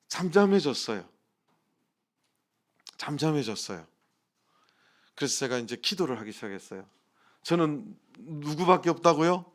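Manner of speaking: ordinary delivery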